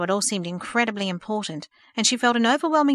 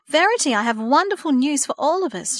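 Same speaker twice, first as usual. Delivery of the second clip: higher, louder bursting with good news; excited exclaim; 6 semitones higher, 4 dB louder than usual. Acted. Mother shares wonderful news with daughter, excited. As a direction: announce it with joy. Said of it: "she exclaimed".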